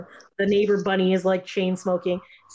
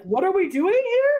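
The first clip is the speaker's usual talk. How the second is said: mock cult leader; 12 semitones higher, 4 dB louder, slower than usual. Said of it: "He's like".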